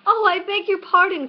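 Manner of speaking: high, loud, hurried apology; hasty cry. A narrator's reading. Alice apologising to the frightened mouse, hasty high cry. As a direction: blurt the apology in alarm. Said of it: "cried Alice hastily".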